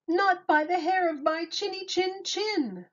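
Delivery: high squeaky piglet voice; little pig. A narrator's reading. First pig answering the wolf, high piggy voice. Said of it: "the pig replied".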